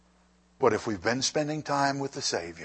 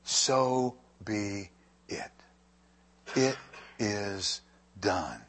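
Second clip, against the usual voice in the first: slow solemn meaning; 2 semitones lower, much slower than usual. Shown as a demonstration. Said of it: "what it means is".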